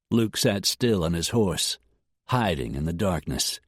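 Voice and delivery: ordinary delivery, nothing marked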